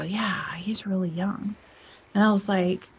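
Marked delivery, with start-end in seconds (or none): 0.0-1.6 unvoiced whisper, slow and soft; soft conspiratorial whisper; whispered agreement. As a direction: breathe the words out, hushed. Whispering agreement in retold chat.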